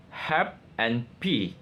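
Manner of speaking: HAPPY split slowly as "hap and p"; syllable breakdown. Shown as a demonstration. Student presenting two syllables of happy, slow and separated. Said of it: "syllable breakdown".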